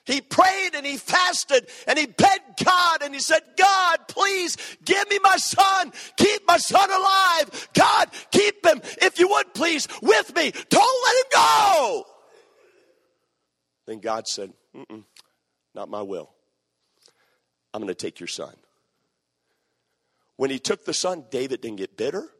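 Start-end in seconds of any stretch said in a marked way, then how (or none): 0.0-12.1 high, loud anguished cry to God; a father's desperate plea, shouted high; desperate pleading. Retold or imitated. David begging God for son. 14.8-18.5 low, soft, calm God voice; David's God refusing him, low and quiet. Retold or imitated.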